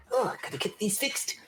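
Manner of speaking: high muttered gnome aside; grumbling gnome. Acted. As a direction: grumble while climbing. Gnome climbing a step.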